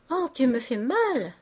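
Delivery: high-pitched cry of pain. A narrator's reading. Daughter crushed in her father's wild embrace, pained cry. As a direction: protest at being hurt, pitch jumping up. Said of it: "si délirante qu'elle dit".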